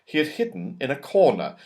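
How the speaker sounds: usual speaking voice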